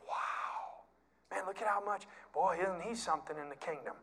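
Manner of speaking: breathy, hushed mock admiration; awed onlooker. Churchgoer gawks at nickel bags, mock hushed awe. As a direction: gasp softly at the show-off giver.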